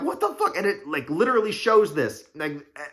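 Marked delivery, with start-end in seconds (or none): 0.0-0.6 shocked squeal. Retold or imitated. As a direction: shriek it in comic disbelief. They're like, shocked Illuminati.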